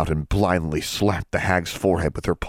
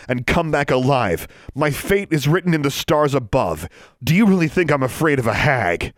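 boastful and grand; 9 semitones higher than usual. Acted. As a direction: lay it on thick to scare. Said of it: "Might as well lay it on thick".

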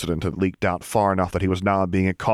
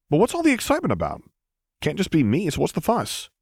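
higher, quick curious asking; curious question; 9 semitones higher than usual. A narrator's reading. Luke asking troopers about the excitement, higher and quick. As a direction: ask eagerly, picking up pace.

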